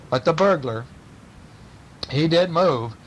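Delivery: ordinary delivery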